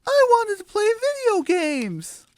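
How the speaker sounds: very high, put-on chick voice; squeaky cartoon "aw, man"; disappointed chick. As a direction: groan it like a let-down kid chick. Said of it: "says Flower".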